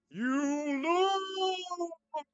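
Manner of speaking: high loud shriek; ghostly scream. Acted. Ghost scare in diary.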